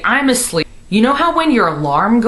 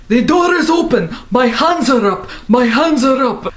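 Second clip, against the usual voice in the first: police raid shout; 6 semitones higher than usual. Retold or imitated. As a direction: bark it like an agent storming in. Raid heard in her dream, replayed.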